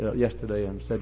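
ordinary talk, nothing marked

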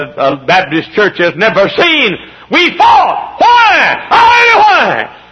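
very high, loud shouted questions; full-force preaching. Real, not acted. Preacher recalling the church's battle, high shouted demand. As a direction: build into fervent shouting.

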